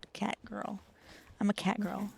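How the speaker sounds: ordinary delivery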